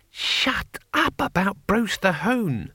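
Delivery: high, slow, drawn-out snap; high-pitched irritated outburst; exasperated. A narrator's reading. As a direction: cut them off, utterly fed up. Fed-up companion cuts off another.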